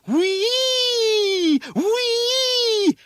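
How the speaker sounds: very high siren squeal; long, loud wailing "whee"; screaming like an engine. A narrator's reading. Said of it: "screaming like some kind of engine".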